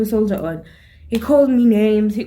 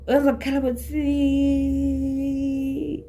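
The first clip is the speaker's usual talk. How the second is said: high ringing noise, held; imitated ringing sound; 6 semitones higher, 3 dB quieter than usual. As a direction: mimic a slap's ringing in the ears. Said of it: "There's this sound, your computer might scream".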